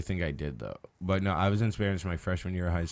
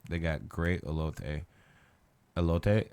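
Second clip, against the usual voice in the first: elote with a Mexican Spanish accent; 3 semitones lower, 3 dB quieter, slower than usual. Shown as a demonstration. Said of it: "I did it a little bit with a Spanish accent".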